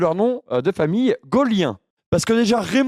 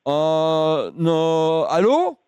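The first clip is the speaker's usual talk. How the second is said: cheeky delivery man voice. Acted. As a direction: play an absurd chirpy delivery man. Second prank call, delivery man.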